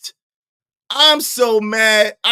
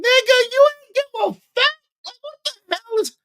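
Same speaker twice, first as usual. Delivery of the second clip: pitched-up disbelieving rant; high exasperated outburst. Real, not acted. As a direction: cry out exasperated.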